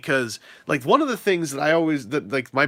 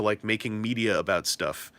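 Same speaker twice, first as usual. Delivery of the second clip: normal talking voice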